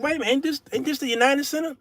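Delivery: high, confused disbelief; mock bewilderment. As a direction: act baffled at a bird's nest up there. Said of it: "I'm like".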